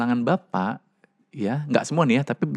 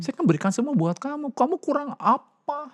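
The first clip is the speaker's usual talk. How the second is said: high-pitched defensive father, slowing at the end; a father protesting he gave everything; indignant father; 11 semitones higher than usual. Retold or imitated. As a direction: play the hurt father asking what more she wants. Father voiced defending himself.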